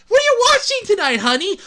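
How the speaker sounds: high, quick mock wife. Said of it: "Could you imagine that?".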